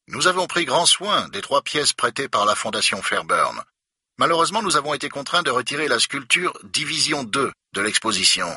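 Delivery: stiff, irritated official explaining; annoyed museum director. A narrator's reading. Director tells Becker of a problem, prim and annoyed.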